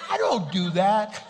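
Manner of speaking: mock protest. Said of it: "I'm like".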